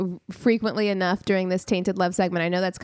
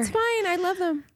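mock lovesick excuse; 8 semitones higher than usual. Friend mocking a forgiving partner in a high voice. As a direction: sigh it like a blind lover.

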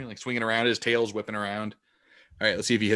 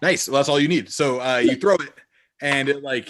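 ordinary delivery

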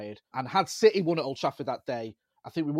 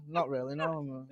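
deadpan; 4 dB quieter, slower than usual. Retold or imitated. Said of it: "And I'm like".

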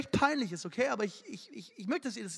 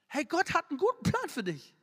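ordinary talk

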